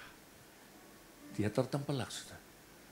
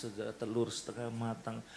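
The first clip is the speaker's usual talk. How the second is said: ordinary delivery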